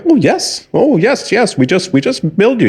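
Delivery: high, quick scammer eagerly confirming. Acted. Scammer reassures the old caller about the bill, eager. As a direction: answer high and over-eager, like a phone scammer.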